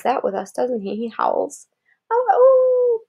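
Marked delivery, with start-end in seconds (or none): 2.1-3.0 high playful wolf howl; mock howl. Parent howling like the dog for Kara, mock wolf howl.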